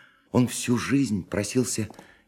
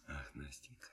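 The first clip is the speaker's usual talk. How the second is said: much softer, sighing Ах; 9 semitones lower, 25 dB quieter than usual. Acted. Dreamer answering the young woman, soft moved sigh. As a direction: breathe it out, moved and tender.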